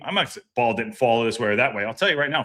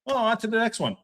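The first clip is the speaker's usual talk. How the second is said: mock shrug-off; 7 semitones higher than usual. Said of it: "it was like".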